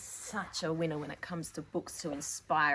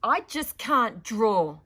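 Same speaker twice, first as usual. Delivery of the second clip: put-on slow, louder, sulky child; glum complaint; 5 semitones higher, 12 dB louder, much slower than usual. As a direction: drag it out like a fed-up child. Sulky girl complains in story.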